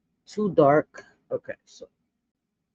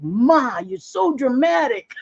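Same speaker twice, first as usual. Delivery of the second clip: teasing son; 8 semitones higher, slower than usual. Retold or imitated. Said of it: "He's like".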